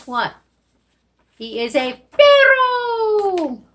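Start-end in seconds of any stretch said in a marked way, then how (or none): 1.4-3.6 high, loud, slow answer; excited reveal. Shown as a demonstration. Teacher revealing the dog word to kids, high and slow. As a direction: draw out the answer with glee.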